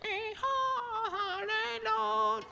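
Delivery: very high, soft slow parrot squeak; squeaky parrot. A narrator's reading. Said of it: "the parrot squeaked right after me".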